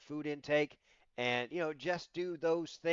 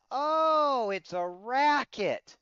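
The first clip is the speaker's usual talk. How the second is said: mock indignation; 11 semitones higher, 7 dB louder, much slower than usual. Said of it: "And I said it, you know, not loud".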